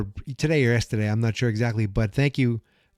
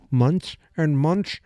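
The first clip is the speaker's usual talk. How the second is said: slow, rising higher with each repeat; drawn-out repetition; 8 semitones higher, much slower than usual. Acted. Fan facing a long wait, exaggerated drawn-out months. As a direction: stretch out each "months", climbing in pitch.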